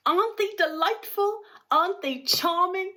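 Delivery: high, gleeful exclaim; delighted cry. A narrator's reading. Mister Wonka showing off his singing workers, gleeful high cry. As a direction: gush over them with glee. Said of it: "cried Mister Wonka".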